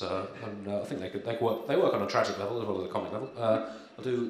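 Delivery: usual speaking voice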